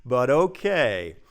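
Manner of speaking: drawn out slowly, unconvinced; slow doubtful reply. Retold or imitated. Answering his carefree brother, slow and unsure. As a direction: stretch it out, reluctantly going along. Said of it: "And I'm like".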